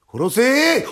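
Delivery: high-pitched defiant yell; big loud voice. Acted. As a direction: dare him at full voice. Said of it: "大きな声出すなって". (開き直って大声で叫ぶ)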